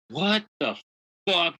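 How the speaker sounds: high, sharp mock outrage; retold angry outburst. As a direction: act out the boss snapping at you. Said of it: "Like, he was angry".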